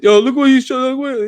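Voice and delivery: very high, loud imitation; high-pitched mocking outcry; mock busybody. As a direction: squeal it like an excited onlooker. Imitates people exposing him.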